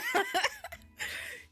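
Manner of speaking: loud high-pitched laugh; high squealing laugh. Real, not acted. Big laugh.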